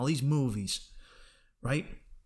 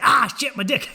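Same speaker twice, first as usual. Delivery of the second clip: a sudden high, loud yelp of pain, then a laugh; mock pained yelp; 10 semitones higher, 10 dB louder than usual. Picturing the crunch, a loud high mock yelp of pain. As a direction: yell it like it just got crushed.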